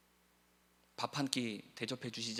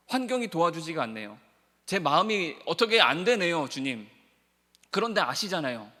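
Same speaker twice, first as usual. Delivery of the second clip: louder, higher anguished confession to the Lord; Peter pleading with Jesus; 8 semitones higher, 11 dB louder than usual. Acted. Peter confessing his failure to Jesus, anguished pleading. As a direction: pour out Peter's shame and longing, pleading. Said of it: "베드로가 뭐라고 합니까?".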